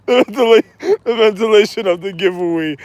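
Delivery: ordinary delivery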